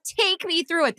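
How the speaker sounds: mock stiff reporter. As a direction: parody the stiff reporter line.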